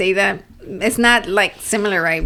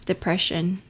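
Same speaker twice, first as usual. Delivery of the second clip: soft, downcast imitation; mock depressed; 2 semitones lower, 8 dB quieter than usual. Reader naming his book's subject, quietly mock depressed. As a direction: drop the volume like someone retelling gloom. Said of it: "like, depressed".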